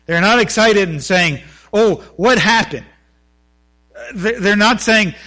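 loud, rising sermon delivery; fervent preaching. Acted. Preacher pleading with his church, loud and impassioned. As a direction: pastor urging the congregation, preaching loud and fervent.

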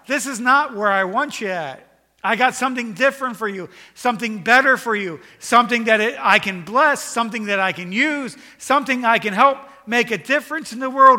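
loud, high-pitched sermon delivery; full-force preaching. Real, not acted. Preacher voicing God calling to Adam and to hearts, full-force preaching. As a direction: preach it loud and high, driving every phrase.

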